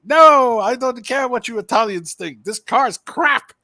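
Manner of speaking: put-on high, slow, impatient Austrian German accent; Niki Lauda's Austrian accent. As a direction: driver to the Italians, in a mock Austrian German accent. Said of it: "who doesn't brook any nonsense".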